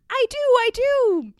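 put-on high, loud child's squeal; overjoyed little boy squealing; excited squeal. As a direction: squeal it eagerly, drawing it out. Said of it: "Harry squealed".

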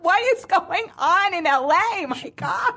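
high-pitched, laughing astonishment; amused disbelief. Retold or imitated. Reacting to friend's horn outburst, amused disbelief. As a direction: exclaim it, aghast and cracking up.

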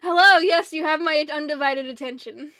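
loud, hammed-up attentiveness; mock eager interest. Ultra Magnus hearing Swindle's deal, loudly all ears. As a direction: pounce loudly on the offer.

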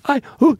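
high mock gasp, unable to speak; hyperventilating. Hysterical girl trying to answer Joey, mock hyperventilating. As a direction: mimic a panicked yelp in place of an answer. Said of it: "she's hyperventilating now".

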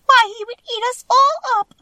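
very high squeak of alarm; high-pitched little mouse cry; crying out, mouse queen. A narrator's reading. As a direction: squeak it out as a startled tiny queen. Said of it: "cried the little queen".

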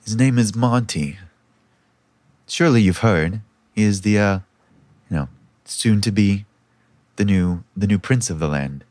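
proud princess in a very low voice; low, halting name-dropping of her betrothed; Myra. Acted. Princess telling a stranger who her bridegroom is, proudly low-voiced.

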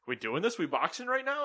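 squeaky rising kangaroo challenge; a hint of a cocky boxer. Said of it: "the kangaroo be like".

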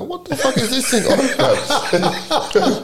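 high-pitched, cracking up through the words; laughing disbelief. Retold or imitated. Man retelling his bafflement at the date, laughing disbelief. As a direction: squeal it in mock bewilderment, laughing.

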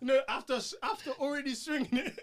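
laughing high and loud. Real, not acted. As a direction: crack up mid-sentence. Laughing while telling the punchline.

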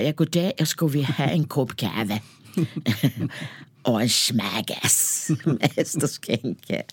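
mock Danish, slow and drawn-out; ordering coffee in made-up Danish, slowly; fake Danish. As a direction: bluff your way through it in fake Danish. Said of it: "man låtsades att man kunde danska".

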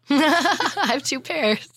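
laughing. Real, not acted. Laughing while admitting it.

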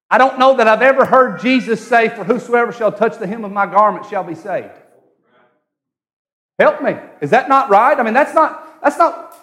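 high, insistent sermon voice; challenging preaching. Acted. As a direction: press the congregation for agreement.

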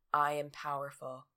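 low, flat, unconvinced I am powerful; faked affirmation. Groggy at the mirror, a low half-hearted affirmation. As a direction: say it into the mirror without believing it. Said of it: "You're not feeling it. You're just faking it".

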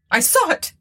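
high, agitated insistence; frightened cry. A narrator's reading. Tabitha grasping the old woman convulsively, frightened. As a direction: blurt it out in high-pitched dread.

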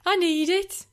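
high-pitched mock begging; exaggerated high plea; mimicked pleading. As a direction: parody a friend's desperate put-on voice. Mimicking the co-host's put-on voice.

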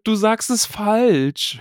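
a schoolgirl scolding her partner; mock nagging; nagging Hermione. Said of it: "hörte Harry Hermine meckern".

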